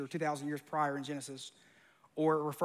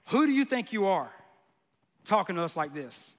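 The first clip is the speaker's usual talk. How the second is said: angry; 5 dB louder than usual. Acted. Angry crowd confronting Jesus, indignant and loud. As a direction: bristle at him, hit WHO hard. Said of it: "This is where they start getting angry".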